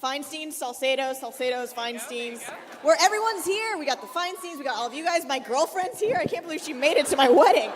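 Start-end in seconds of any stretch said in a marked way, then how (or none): 2.8-7.7 mock excited. Playfully announcing guests at mock wedding.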